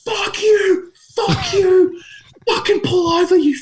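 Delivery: high-pitched reenacted screaming at a driver; road-rage yelling. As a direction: act out yelling out the car window, voice way up. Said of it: "I'm yelling at him".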